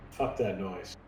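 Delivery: ordinary talk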